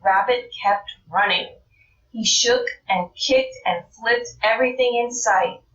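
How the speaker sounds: plain reading voice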